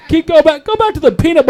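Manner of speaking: high-pitched complaining plea; mock audience protest. Audience objecting to wife joke, mock protest. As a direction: voice an offended crowd begging him back. Acts heckling audience member.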